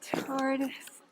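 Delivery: ordinary delivery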